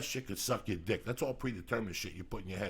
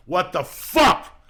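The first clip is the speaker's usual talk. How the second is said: slow, drawn-out, much louder What the fuck; loud outburst; 7 semitones higher, 14 dB louder, much slower than usual. Real, not acted. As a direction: bellow it in disbelief. Wild shout to cohost.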